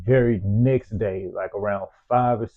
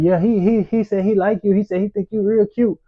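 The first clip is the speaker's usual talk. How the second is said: mock girls teasing; 9 semitones higher than usual. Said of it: "And they were like".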